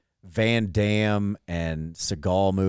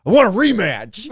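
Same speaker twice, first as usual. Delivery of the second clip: high, louder demanding whine; mock kid demand; 9 semitones higher, 5 dB louder than usual. Little brother demanding a rematch, high mock whine. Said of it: "And he goes".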